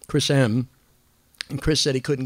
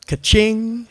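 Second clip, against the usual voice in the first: cash register ka-ching; 11 semitones higher, 9 dB louder than usual. Retold or imitated. Cash register sound effect.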